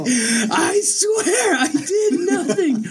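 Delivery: very high, drawn-out wail of innocence; comically hammed-up panic, half laughing; mock desperate plea. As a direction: wail it slowly as if begging to be believed. Friend desperately denies poaching, acted.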